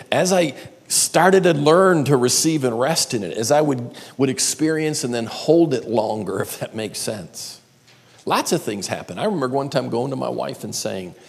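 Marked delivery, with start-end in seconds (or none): none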